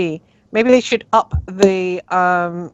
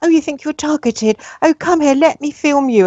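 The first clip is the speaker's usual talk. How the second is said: higher, sugary mock-sympathetic documentary maker; mocking fake sympathy; 7 semitones higher than usual. Mocking the documentary makers to the host, higher fake-sympathetic voice. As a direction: parody a fake-caring TV crew coaxing people. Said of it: "What, say to people".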